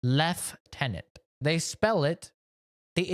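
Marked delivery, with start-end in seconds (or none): 0.0-1.0 "Lieutenant" slowly, as lef-TEN-ant; British lef-TEN-ant. Retold or imitated. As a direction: put a clear F in "Lieutenant": lef-tenant. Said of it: "In the U K, they say".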